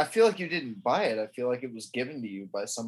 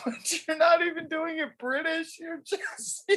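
laughing. Real, not acted.

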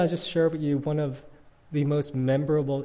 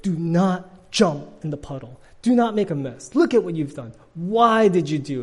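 put-on louder, higher scolding of the child; scolding dad; 3 semitones higher, 7 dB louder than usual. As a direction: tell him off, exasperated. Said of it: "I said".

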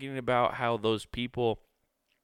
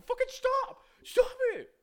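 very high falsetto, begging them to stop; high falsetto plea from a bullied kid; picked-on kid yelling. Retold or imitated. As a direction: squeal it like the kid at the back of the bus who's had enough. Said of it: "someone in the back was going".

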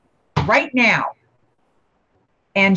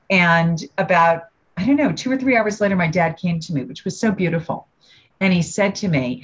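ordinary talk

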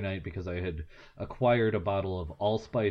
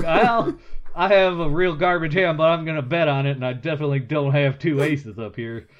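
a poker bluff in an exaggerated Southern accent; 7 semitones higher, 10 dB louder than usual. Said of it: "which is the voice you use when you're lying".

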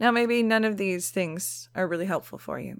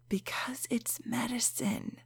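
whispered aside; breathy whisper; 3 semitones higher, 9 dB quieter than usual. Real, not acted.

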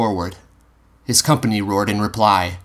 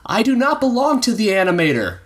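put-on high, young armored boy calling across; called out; 14 semitones higher than usual. A narrator's reading. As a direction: call out to the man, pleading his case. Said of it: "he called to him".

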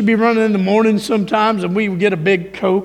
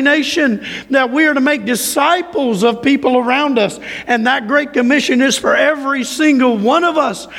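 higher-pitched, driving sermon delivery; hararetli vaaz; 4 semitones higher than usual. Acted. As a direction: preach it with insistent, rising force. Preaches at full force.

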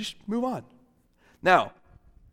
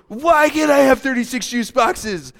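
higher, louder demanding whine; 8 semitones higher, 8 dB louder than usual. Acted. Child insisting to mommy, louder whine. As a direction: push the demand harder.

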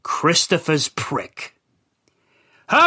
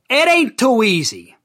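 ordinary delivery, nothing marked

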